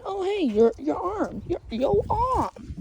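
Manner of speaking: high-pitched goofy toy creature; silly high cartoon voice; goofy character voice. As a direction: put on a high voice, stretching the last word. Voices Bluggy chilling, greeting.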